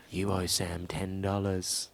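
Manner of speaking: low, soft, slow hypnotic murmur; mock sleep whisper. Friend planting a suggestion in a sleeper's mind. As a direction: breathe it into a sleeper's ear.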